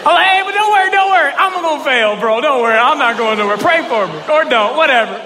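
high, loud backpedalling; comically frantic don't-worry; hasty reassurance. Retold or imitated. Hastily reassuring his pastor.

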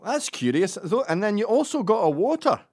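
high, loud Scottish busybody; pry like a curious Scotsman; Scottish accent. Acted. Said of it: "with a Scottish accent".

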